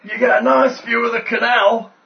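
high, grinning innkeeper voice. Acted. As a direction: grin through the words. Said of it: "grins Rudolph".

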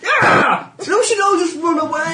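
excited shout. Real, not acted. Panicked flight in game.

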